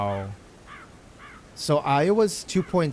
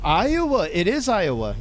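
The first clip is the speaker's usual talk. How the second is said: triumphant; 7 semitones higher, 4 dB louder than usual. Real, not acted. Finds search answer.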